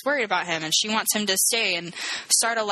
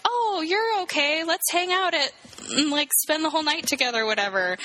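imitated won-over woman; 9 semitones higher than usual. Woman to suitor at the bar, mock approval. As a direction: lightly play a woman giving in.

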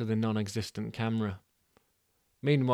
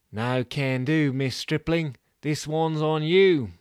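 slow, louder, firm refusal; club voice; 4 semitones higher, 6 dB louder, slower than usual. Acted. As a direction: slowly turn her down.

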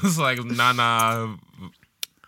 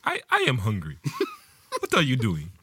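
put-on African accent. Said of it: "an African guy for my bird".

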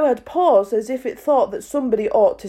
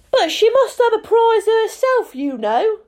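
much higher, squeaky little voice; mouse voice; 13 semitones higher, 4 dB louder than usual. A narrator's reading. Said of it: "said the mouse".

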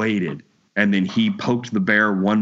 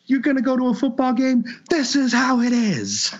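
incredulous guy imitation; 13 semitones higher than usual. Voicing the incredulous biker.

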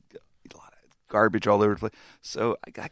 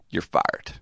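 low, deadpan dismissal; contained anger, retold; 6 semitones lower than usual. Boss firing his manager after the reprimand, contained retold anger. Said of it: "looks at him real angry".

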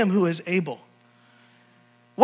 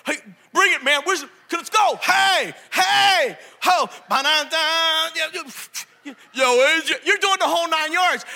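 fired-up, higher and shouting in bursts; hyped Rocky fan; 7 semitones higher, slower than usual. Retold or imitated. Kid leaving the Rocky movie, shouting fired up and higher. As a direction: bounce out pumped up like a boxer. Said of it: "you're like this".

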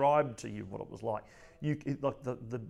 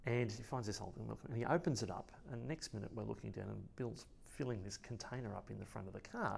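ordinary delivery

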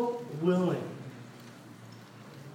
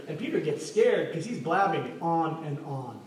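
normal talking voice

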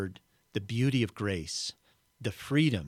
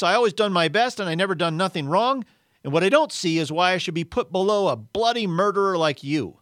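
loud, high-pitched, argumentative outburst; belligerent, aggrieved character voice; indignant ghost; 8 semitones higher, 8 dB louder, faster than usual. Acted. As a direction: argue back, insisting on your rights. Ghost's protest continues.

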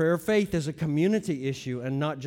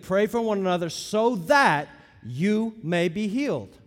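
slow, heavy stress on "so that"; deliberate beats from "so that" onward; emphatic; 2 semitones higher than usual. Shown as a demonstration. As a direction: punch "so that", then space out "you may be healed". Stresses the promised result of prayer.

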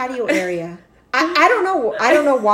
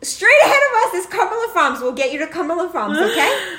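higher, laughing through the words; laughing reassurance; 6 semitones higher, 3 dB louder than usual. Retold or imitated. Mom laughing as she reassures sick son. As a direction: soothe him while cracking up.

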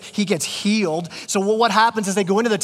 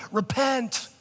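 "Repent" pitched higher, a mild mock condemning call; harsh sign guy; 4 semitones higher, 4 dB quieter than usual. Preacher mocking street-corner sign holders, mild harsh condemnation. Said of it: "the guys with the big signs".

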